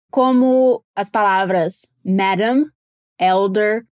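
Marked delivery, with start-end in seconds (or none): 2.0-2.6 "madam" slowly, with a light tapped D; lower, careful "madam", D barely tapped; soft D sound. Shown as a demonstration. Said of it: "quase soa como um D". 3.2-3.9 "elder" with a weak, light D; careful lower-pitched "elder", gentle D; soft D sound. Shown as a demonstration. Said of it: "só que bem fraquinho".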